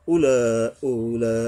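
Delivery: উলা said deliberately with drawn-out vowels; four-alif elongation. Shown as a demonstration. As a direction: stretch the sounds of উলা. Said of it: "চার আলিফ টানতে হবে". (উলা টেনে পড়া)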